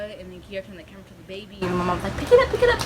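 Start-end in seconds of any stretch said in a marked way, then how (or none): 2.2-2.8 high-pitched frantic mom orders; urgent ordering. Retold or imitated. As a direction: imitate a mother urgently giving commands. Mom's urgent orders about baby.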